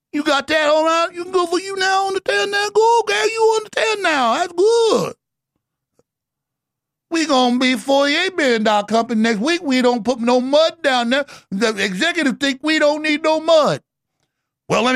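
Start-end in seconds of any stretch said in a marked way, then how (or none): 0.1-5.1 much higher, thin mocking squeak; mocking squeaky voice. Host mocks a drilling boss in a squeaky voice. High mocking voice, odd words. 7.1-13.8 Cajun executive. Retold or imitated. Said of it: "the executive in the coon-ass voice".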